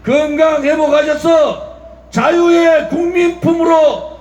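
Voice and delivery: high-pitched rousing shout; fiery rally speech. Real, not acted. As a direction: call it out to the crowd.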